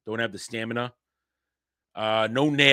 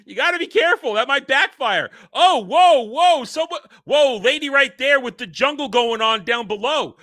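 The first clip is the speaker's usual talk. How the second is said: high, loud hammed-up indignant yelling; exaggerated angry complaint; mock outrage; 8 semitones higher, 5 dB louder than usual. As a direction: rant like an offended brute. Acted angry brute ranting.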